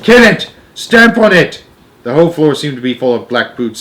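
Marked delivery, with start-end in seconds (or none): 0.0-1.6 high, loud mob orders; frantic high-pitched commands in a chase; urgent shouting. A narrator's reading. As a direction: shout for the kill. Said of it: "they were shouting".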